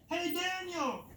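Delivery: high, drawn-out calling voice; high storyteller's holler without real volume; mock shout. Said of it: "shouts in".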